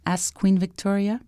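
usual speaking voice

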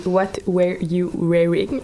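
English title in a careful French accent. Real, not acted. Guest giving the English title despite her accent, French-accented. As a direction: say it slowly with a Québec French accent. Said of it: "j'ai vraiment un accent".

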